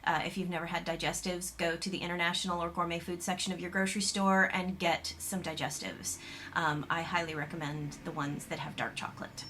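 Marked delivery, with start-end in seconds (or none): none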